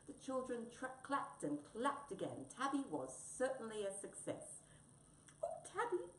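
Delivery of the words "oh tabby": very high squeaky mouse voice; little mouse, high and amused; giggly mouse. Acted. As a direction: tease in a tiny high mouse voice. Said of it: "giggled Mouse".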